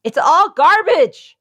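high, slow, drawn-out declaration; emphatic. Acted. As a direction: stretch it out like a big verdict. Mock rant against CNN.

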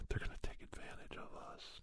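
hushed conspiratorial whisper. Retold or imitated. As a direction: lean in and whisper it. Whispered aside of echo chamber.

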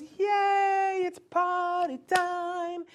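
high, loud, stretched-out yay; whole family cheering the toddler on; the right way, a big happy shout; excited cheer. Shown as a demonstration. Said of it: "the whole family goes".

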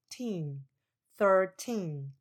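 put-on teen alone, then thirteen with teen stressed. Shown as a demonstration. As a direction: draw out the teen in thirteen, falling. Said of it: "它的重音是在后面第二个音节". (thirteen 强调第二音节 teen)

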